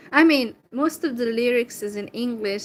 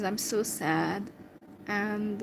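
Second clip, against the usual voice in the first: normal talking voice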